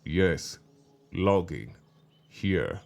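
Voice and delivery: low, falling, word-by-word answer; bitter confirmation. A narrator's reading. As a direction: confirm grimly, pausing after each word. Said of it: "He smiled bitterly".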